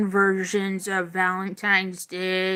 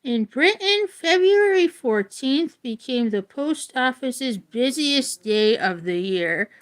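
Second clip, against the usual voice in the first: clear, measured book-reading voice; reading aloud; 3 semitones higher than usual. Acted. Reading a Valentine's history book to viewers, in an even read-aloud voice. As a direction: read it out like a class textbook.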